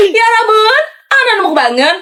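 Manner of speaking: high, rising spirit chant; possessed spirit voice. Pretend. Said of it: "pakai suara kodam gue".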